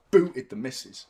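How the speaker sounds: ordinary talk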